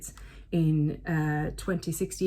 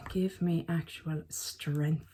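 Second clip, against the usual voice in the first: slow, low, hushed exasperation; mock-weary quiet appeal; exasperated plea; 4 semitones lower, 6 dB quieter, much slower than usual. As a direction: beg for patience slowly under your breath. Exasperated plea after sigh.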